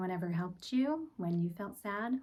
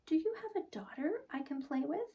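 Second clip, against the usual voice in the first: put-on higher, quick childlike ask; eager little girl; 9 semitones higher than usual. Laurel asking the newcomer about a daughter, higher eager child.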